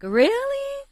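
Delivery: a high incredulous yelp of disbelief; shocked disbelief. Retold or imitated. Hearing her partner's final ultimatum, a shrill shocked really.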